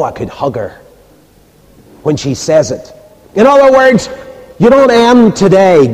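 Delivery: ordinary talk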